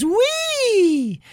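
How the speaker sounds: squeaky high toy squeal; giggly doll voice. Retold or imitated. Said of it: "the little female doll goes".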